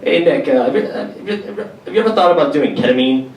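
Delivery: hammed-up chirpy mother; imagined mom. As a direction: cheerfully suggest drugs as mom. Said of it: "she'd be like".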